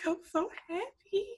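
mock-tearful mom, pitched high; imitated happy crying. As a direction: lightly imitate a mother crying for joy. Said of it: "like, she was crying".

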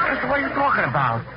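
ordinary talk

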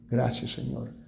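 quiet, reverent thanks; hushed gratitude. Retold or imitated. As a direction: breathe it out like a prayer. Father thanking God after execution.

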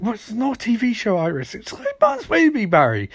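high-pitched retort; mock bickering. Retelling the pair's argument, mock exasperated bickering. They start arguing.

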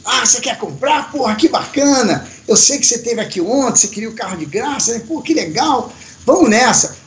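a hint of an upbeat English dealer; voicing the sales chief. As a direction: play the cheerful salesman. Sales chief voiced, retold.

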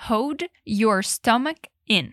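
"Hold your stomach in" spelled out slowly; slow it right down, word by word. Shown as a demonstration. Teacher slowing the phrase so students can follow. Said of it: "vou falar bem devagar".

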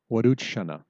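"Waduch shana" falling at the end; reply firmly "Waduch shana"; teacher acting the answer; statement answer. Shown as a demonstration. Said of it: "run through that again in ... quick conversation".

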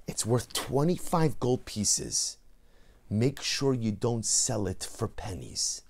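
slow, soft, earnest; tender advice. Acted. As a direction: gently urge him, slowly and quietly. Rebbe tenderly holding pauper's hand.

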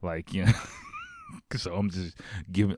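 ordinary delivery

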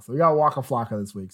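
normal talking voice

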